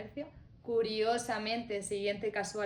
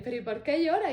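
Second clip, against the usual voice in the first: puzzled Luis; 7 semitones higher, 7 dB louder than usual. Retold or imitated.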